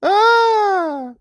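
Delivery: very high, drawn-out "ah"; amused wail. Real, not acted. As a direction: let out a long high hoot of amusement. Laughing at the hilarious contradiction.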